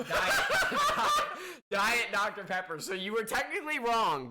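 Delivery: high laugh bursting into the answer; laughing loudly. Real, not acted. Revealing results, laughing.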